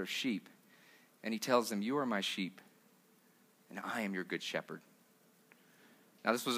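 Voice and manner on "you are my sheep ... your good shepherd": much softer and lower, gentle promise; hushed, tender words of a shepherd; tender Jesus. Retold or imitated. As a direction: speak it like a quiet reassurance to the flock. Jesus speaking to his disciples.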